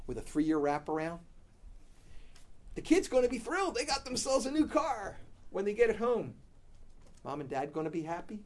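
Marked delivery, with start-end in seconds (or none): 2.7-6.3 higher, louder, bubbling with glee; mock thrilled. Parents picture kids thrilled at new car, gleeful.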